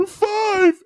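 high-pitched tearful squeak; mock crying. Crying boy gives in and picks his days, mock crying. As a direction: squeak it out in fake sobs. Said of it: "whoever's in front of us crying".

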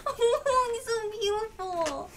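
high, loud, slow wail; drawn-out cry of adoration; overwhelmed, tearful adoration. Real, not acted. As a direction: wail it out, close to tears. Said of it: "I'm trying not to cry".

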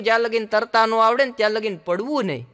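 ordinary talk, nothing marked

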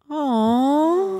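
high cooing aw; baby-talk coo. Acted. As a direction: coo like at a puppy. Cooing at the question card.

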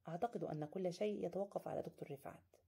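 ordinary delivery, nothing marked

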